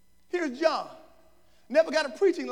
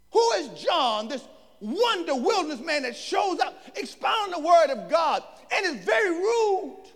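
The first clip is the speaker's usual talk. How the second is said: raised, higher preaching voice; full-force preaching; 7 semitones higher, 7 dB louder than usual. Real, not acted. Preacher asking the congregation who John is, full-force preaching. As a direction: proclaim it to the congregation.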